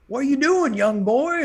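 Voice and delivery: put-on high, loud drawling old farmer. Telling a story, voicing the old farmer calling out high. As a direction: play the startled kind farmer calling out.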